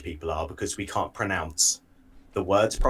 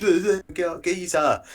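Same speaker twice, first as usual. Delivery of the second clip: loud, high mocking blabber; hammed-up TV geezer caricature; Jamie Oliver; 9 semitones higher, 4 dB louder, much slower than usual. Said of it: "he would come on T V like".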